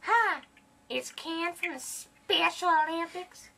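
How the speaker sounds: high put-on caller voice; squeaky prank-call voice. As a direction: pitch up into a silly fake persona.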